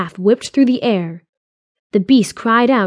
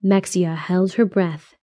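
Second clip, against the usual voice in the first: ordinary delivery, nothing marked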